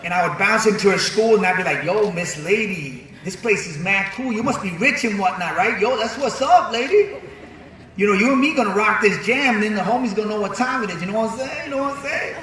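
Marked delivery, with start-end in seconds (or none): 1.8-12.4 put-on street New York accent, rising at the ends. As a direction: swagger through it in a street accent. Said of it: "that's how I used to sound".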